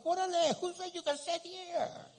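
high, loud, put-on indignant yell; mock outraged shout. Member finds someone in seat.

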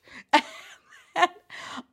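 breathy high burst of laughter; airy laugh. Real, not acted. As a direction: laugh in disbelief. Laugh amid retold fight.

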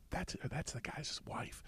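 hushed, slow whispered aside; confidential whisper. Retold or imitated. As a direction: whisper it to a friend in passing. Aside whispered to friend in passing.

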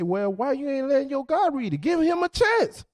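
demanding they give the guy a chance, shouting; shouting at the women. Retold or imitated. Speaker telling women to let their man read the book, shouting. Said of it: "I say".

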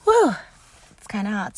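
a gasp after hot coffee; while sipping a drink. Real, not acted. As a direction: talk just after a hot sip. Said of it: "Let me take a sip of my coffee".

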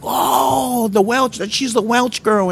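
put-on high, delighted "oh, the Welch girl"; light up with joy at seeing her; excited greeting. Church folks greeting his wife, high and delighted.

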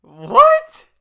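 very high, loud outraged what; shocked yell. Real, not acted. Friend yelling in shock at the fake punchline. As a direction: shout it in total disbelief. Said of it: "listener shocked by the twist".

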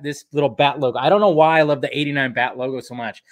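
ordinary talk, nothing marked

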